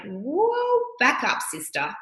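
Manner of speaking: sassy pushback. Retold or imitated. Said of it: "and I went".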